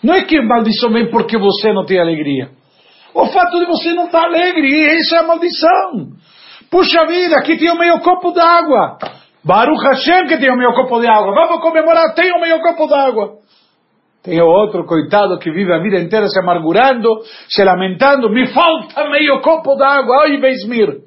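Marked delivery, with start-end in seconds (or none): 3.1-13.4 much higher, bright celebratory voice; grateful man rejoicing over little; joyful gratitude. Acted. Cheerful grateful person voiced. 18.3-21.0 lament. Acted. Lamenting person voiced.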